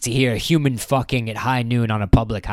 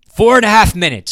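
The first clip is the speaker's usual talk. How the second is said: growled; 9 semitones higher, 8 dB louder than usual. A narrator's reading. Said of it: "growled Sergeant Peace".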